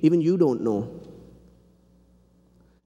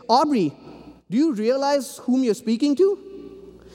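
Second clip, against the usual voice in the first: higher, indignant mock protest; objecting listener; 11 semitones higher than usual. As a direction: play the offended seminarian.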